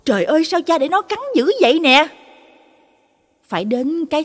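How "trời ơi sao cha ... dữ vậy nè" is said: high, loud and fast alarmed cry; panicked shout. A narrator's reading. Son seeing the dog bite his father, panicked shout. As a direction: yell it in a panic. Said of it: "nó vừa la lớn hốt hoảng".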